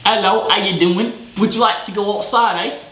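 hammed-up British-Australian greeting; botched British accent, Australian-sounding. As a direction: attempt British and land near Australian. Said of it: "That sounds Australian".

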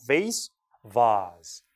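vase as "vayss", then vase as "vahz"; American then British. Shown as a demonstration. Teacher contrasting for students, vase "vayss" then "vahz". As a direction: switch vase from "ay" to "ah". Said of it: "완전 달라지죠".

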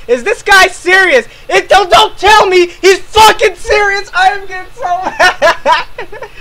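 high, loud exasperated rant; outraged yelling. Acted. YouTuber reacting to a clip, outraged yelling. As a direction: yell in hammed-up disbelief, voice climbing.

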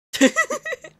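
loud, high-pitched burst of giggling; high giggle. Real, not acted. Streamer amused by the character, high giggle.

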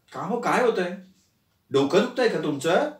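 soft, concerned asking; worried. A narrator's reading. Wife softly asking if husband's head hurts. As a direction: gently check on him. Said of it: "अरुंधतीनं त्याला काळजीने विचारलं".

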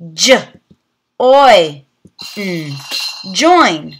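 "J, oi, n" said as separate sounds, then blended into "join"; segment, then blend; sounding out. Shown as a demonstration. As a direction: phonics teacher sounding out. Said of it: "practice the oi sound".

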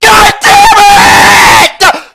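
high, loud outburst of rage, imitated; pissed-off shout. Streamer imitating Greek slamming his desk, pissed-off shout. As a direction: mimic a furious gamer exploding. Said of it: "How pissed they are".

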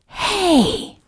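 high, sharp shout. A narrator's reading. Cranky dog calling after Santa, shouting. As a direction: call out a high "Hey!". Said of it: "shouting out".

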